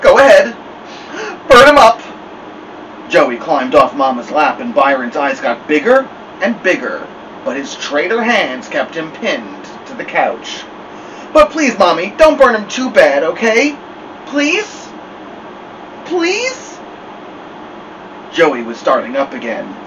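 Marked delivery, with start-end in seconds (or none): 0.0-2.0 high, loud sobbing cry. Acted. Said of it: "then sobbed". 11.3-16.8 rising, higher and higher pleading; a child begging, voice climbing; begging little girl. Acted. Joey begging mother, crying.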